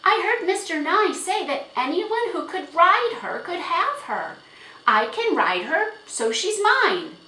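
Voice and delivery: high, loud, eager protest; insistent girl. A narrator's reading. Girl arguing to father that the horse is hers, insistent. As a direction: argue your claim at a high pitch.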